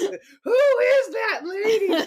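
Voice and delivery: very high, slow, stretched-out wail; imitating a distraught movie cry; mock wail. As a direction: draw it out in hammed-up falsetto. Repeats the character's outburst.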